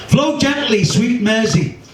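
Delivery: Flow gently, sweet Mersey lifted high and lilting; lyrical poem opening. Acted. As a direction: open the ballad-poem with a soaring, tender line. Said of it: "this is my take".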